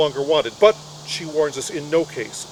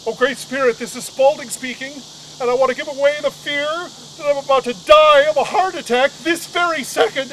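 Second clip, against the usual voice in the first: higher, building to louder; panicked prayer; 8 semitones higher, 4 dB louder than usual. Retold or imitated. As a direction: pray in rising panic. Panicked prayer, pitch climbing.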